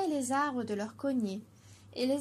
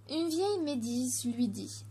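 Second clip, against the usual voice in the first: usual speaking voice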